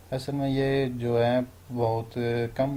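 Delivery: normal talking voice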